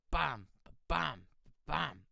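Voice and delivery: mock beat vocal; punchy beat. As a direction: hit each bam like a drum. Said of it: "you're like".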